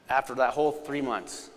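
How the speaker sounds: usual speaking voice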